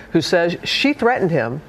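ordinary delivery

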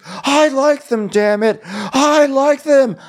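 high, slow, whiny insistence; mocking Shep defending the meatballs. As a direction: whine it up high and stubborn. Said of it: "And Shep goes".

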